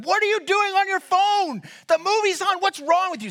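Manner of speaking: high-pitched outraged rant; furious yelling. Retold or imitated. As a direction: berate someone at the top of your range. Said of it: "he starts yelling at him".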